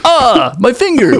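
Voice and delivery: high, hammed-up ouch; mock yelp of pain. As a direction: squeal about the finger, cartoonish. Bitten by the doll.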